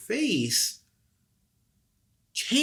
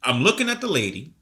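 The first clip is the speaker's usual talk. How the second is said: ordinary delivery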